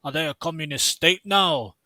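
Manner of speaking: poor, hammed-up Scottish accent. Host rereads a tweet as someone Scottish, poor Scottish accent. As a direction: read it in a badly faked Scottish accent, lifted at first. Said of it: "someone who's Scottish".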